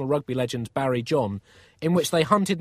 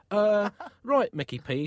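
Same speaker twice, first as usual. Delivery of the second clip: hesitant, higher-pitched start; awkward; 7 semitones higher than usual. A narrator's reading. Pope unsure of the artist's idea, awkward. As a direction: stall awkwardly before agreeing. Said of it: "said the Pope awkwardly".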